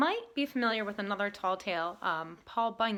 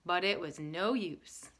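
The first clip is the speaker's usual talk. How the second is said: ordinary delivery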